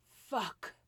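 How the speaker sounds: high, loud cry of pleasure; scream. Acted. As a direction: cry out at full force. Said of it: "I screamed".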